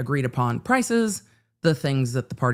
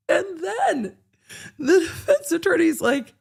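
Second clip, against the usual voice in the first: much higher, breaking into laughter; laughing through the story; 13 semitones higher than usual. Real, not acted. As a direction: tell it while losing it to laughter. Still laughing while telling.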